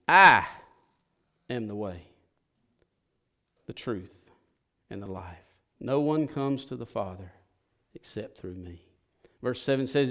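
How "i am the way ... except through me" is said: much softer and lower, slowing on each phrase; solemn reading. Real, not acted. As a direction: lay down each word gently. Reads Jesus' key verse slowly.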